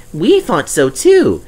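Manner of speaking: high, eager little engine voice; Percy's voice. Acted. Percy reminding Douglas, in a high eager voice. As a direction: play the small cheeky tank engine.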